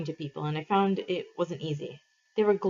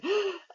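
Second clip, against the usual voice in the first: a sudden high gasp of alarm; a startled high gasp; sharp high gasp; 14 semitones higher than usual. Acted. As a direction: gasp as the truth hits. Realizing they're trapped.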